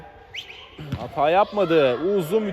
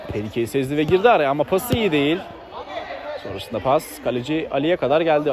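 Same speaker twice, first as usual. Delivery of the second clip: ordinary talk, nothing marked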